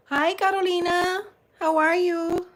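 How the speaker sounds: high, lilting hello; high-pitched greeting. Real, not acted. Greeting Carolina as she joins, high-pitched. Greeting someone joining the chat.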